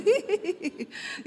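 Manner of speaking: high ghostly giggle; high-pitched spooky cackle; kuntilanak laugh. Pretend. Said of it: "Jatuhnya kayak kuntilanak gitu".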